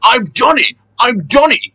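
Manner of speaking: high, puffing cry of victory; triumphant. A narrator's reading. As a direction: celebrate it in two excited bursts. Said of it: "he puffed triumphantly".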